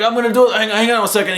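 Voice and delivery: acted-out excitement, high voice; imitated eager player. Acting Mike busy in game.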